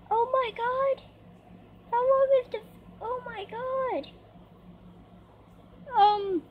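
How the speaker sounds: high-pitched, alarmed disbelief; shaken. Real, not acted. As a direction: react in real shock after a scare. Reacting to the creeper scare.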